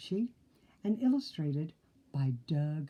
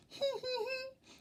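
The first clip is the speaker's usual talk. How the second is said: tiny squeaky giggle, getting softer; high giggle; 8 dB quieter than usual. Acted. Oinky tickled by wolf costume, squeaky giggle. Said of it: "giggled Oinky".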